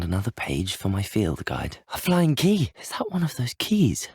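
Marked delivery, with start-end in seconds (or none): 1.9-4.1 high-pitched, excited exclamation; excited discovery. Acted. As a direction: exclaim high, thrilled at spotting it. Game character spots a flying key.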